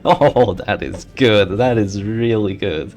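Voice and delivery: loud, drawn-out relish; delighted. Real, not acted. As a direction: savour the good news loudly, stretching it out. Reacting to a lucky game result.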